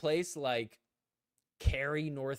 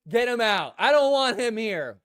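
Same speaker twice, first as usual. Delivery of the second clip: imagined angry demand; 5 semitones higher, 11 dB louder than usual. Retold or imitated. Imagining Leia demanding he be thrown out, in a loud mock rant. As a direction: ham up an angry player ordering him removed. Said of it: "she was gonna see me there and be like".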